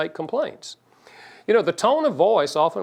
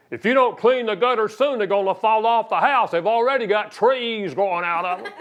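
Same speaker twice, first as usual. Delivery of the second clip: higher, louder scolding with a laugh breaking in; nagging demand; 7 semitones higher, 3 dB louder than usual. Retold or imitated. Spouse nagging partner to clean the gutters, scolding. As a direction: nag him about the gutters. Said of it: "love doesn't say".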